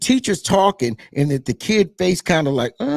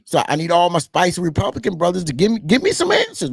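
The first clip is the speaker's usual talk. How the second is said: ordinary delivery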